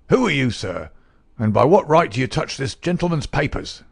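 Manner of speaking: put-on a testy old uncle demanding answers; sharp-crackling old miser. A narrator's reading. Miser confronting the detective over papers, indignant crackle.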